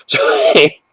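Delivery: high, laughing voice; real laugh breaking into the word; laughing apology. Real, not acted. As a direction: squeak it out through a laugh. Laughs at own firm opinion.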